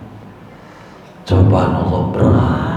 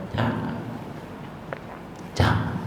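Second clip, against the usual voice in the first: much softer, almost breathed; hushed warning; 15 dB quieter than usual. Real, not acted. Preacher warning the congregation, hushed. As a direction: drop to a near hush to warn.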